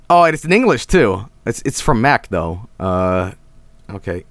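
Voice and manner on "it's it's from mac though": normal talking voice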